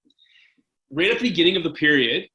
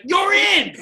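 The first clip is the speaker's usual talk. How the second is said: high-pitched, loud holler; coach yelling; 6 dB louder than usual. Retold or imitated. Coach calling his reluctant backup goalie into the game, yelling. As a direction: yell the order down the bench. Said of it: "Jimmy Halton's yelling".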